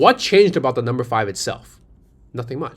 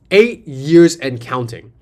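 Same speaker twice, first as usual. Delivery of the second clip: slow and louder, each word weighted; slow emphasis; 4 dB louder, much slower than usual. Shown as a demonstration. Pitching Dior's ad longevity, slow and emphatic. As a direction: land "Eight years and counting." like a punchline.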